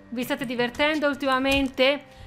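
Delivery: ordinary talk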